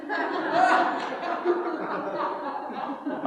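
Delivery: a long soft laugh, the punchline sinking in; hearty laugh. Real, not acted. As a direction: laugh softly at the joke on yourself. Preacher laughs at God's joke.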